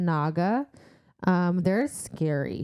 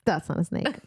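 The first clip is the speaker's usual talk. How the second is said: ordinary talk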